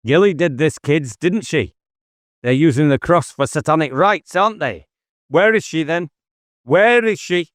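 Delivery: rising urgent demand; urgent calling out. Acted. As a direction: call after the children as they run off. David calling after fleeing children.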